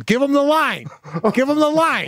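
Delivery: high-pitched impatient yell; exasperated star shouting at the crew; De Niro yelling. Retold or imitated. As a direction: yell the order across a set. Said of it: "De Niro's yelling".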